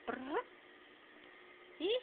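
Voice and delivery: high sing-song pet voice. Real, not acted. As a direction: coo at the kitten. Baby talk to the cat.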